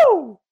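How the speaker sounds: high-pitched "woo"; excited whoop. Real, not acted. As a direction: let out a sudden whoop. Whoops after the proclamation.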